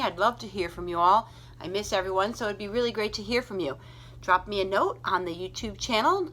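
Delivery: ordinary delivery, nothing marked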